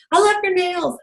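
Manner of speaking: high-pitched imitated gush; gushing compliment. Store girl repeating her compliment on nails, gushing. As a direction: play an admiring fan.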